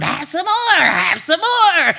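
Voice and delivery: high, loud, slow wicked insistence; pushy urging. Acted.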